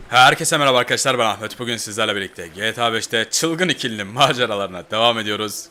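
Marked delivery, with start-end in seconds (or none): none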